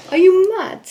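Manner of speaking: bad British accent. Shown as a demonstration. Canadian in London trying the local slang, bad British accent. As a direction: put on a hammed-up, high British accent. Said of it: "My British accent is so bad".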